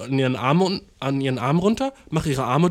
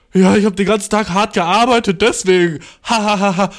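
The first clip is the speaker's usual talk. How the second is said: loud forced cheer; 5 semitones higher, 6 dB louder than usual. Retold or imitated. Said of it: "Und die dann so".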